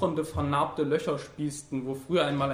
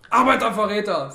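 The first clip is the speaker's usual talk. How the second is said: loud, raised counter-accusation; angry retort; 6 semitones higher, 9 dB louder than usual. A narrator's reading. Wounded communist answers the fascist, loud and angry. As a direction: fling the insult back. Wounded enemies insult each other.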